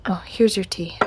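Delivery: ordinary delivery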